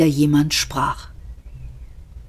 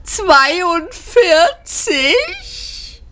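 drawn-out shriek, much higher in pitch; 14 semitones higher, much slower than usual. A narrator's reading. Luung Kual shrieks at the computer in disbelief, slowly drawn out. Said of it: "kreischte Luung Kual los".

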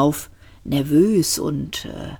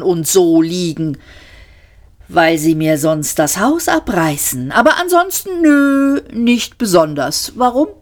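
Arthur, sarcastic; 2 semitones higher than usual. Acted. Arthur telling Ford about the bulldozers, sarcastic. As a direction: play Arthur lying in the mud, ironic.

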